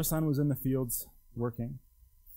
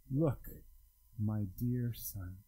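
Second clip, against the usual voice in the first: tender father; 6 semitones lower, 6 dB quieter, much slower than usual. Acted. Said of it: "sit in that greeting".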